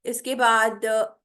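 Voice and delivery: ordinary talk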